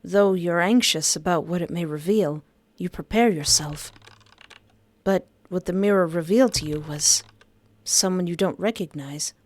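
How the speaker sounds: usual speaking voice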